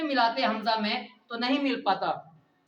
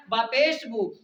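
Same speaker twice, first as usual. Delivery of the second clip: ordinary talk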